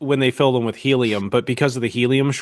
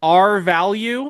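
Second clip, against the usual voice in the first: drawn out slow rising question; 5 semitones higher, much slower than usual. Acted. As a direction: stretch it out slowly. Joking suggestion.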